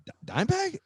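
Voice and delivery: a caught-off-guard double take; retold stunned surprise; surprised. Surprised reply to the call.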